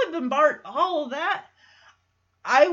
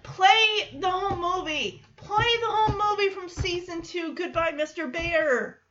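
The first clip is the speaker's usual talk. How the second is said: excited plea; 5 semitones higher than usual. Retold or imitated. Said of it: "I'm like".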